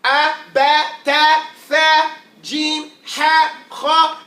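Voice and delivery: "A, ba, ta, tha" crisp and loud, one letter at a time; teacher reciting "A, ba, ta" letter by letter; Arabic alphabet recital. Shown as a demonstration. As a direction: chant out "jim, ha, ha" as separate letters. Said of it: "what are the foreign languages?".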